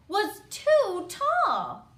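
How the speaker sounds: "too tall" drawn out, high and loud; big, slow punchline on "too tall"; exasperated emphasis. A narrator's reading. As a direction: hit "too" and "tall" hard and slowly. Punchline of repeated chair verdict.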